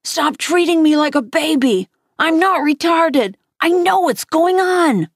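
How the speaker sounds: high, insistent tantrum; child's furious protest, pitch pushed up; angry shrieking. A narrator's reading. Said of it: "I shrieked".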